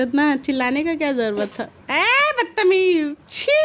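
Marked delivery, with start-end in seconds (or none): 1.9-3.5 slow, drawn-out mock scolding. Real, not acted. Mother teasingly scolding her naughty baby, slow and drawn out. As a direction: stretch it out like a playful telling-off.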